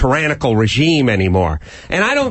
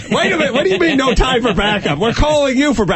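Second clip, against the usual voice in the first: high, baffled protest; officer protesting refusal of backup, high bafflement; mock confused officer; 12 semitones higher, faster than usual. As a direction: sputter in disbelief. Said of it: "confused officer".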